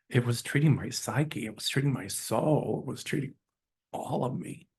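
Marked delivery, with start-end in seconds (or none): 2.9-4.7 fighting back real tears as the words die away; near tears. Real, not acted. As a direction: voice breaking, fading into a whisper. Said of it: "I'm gonna cry".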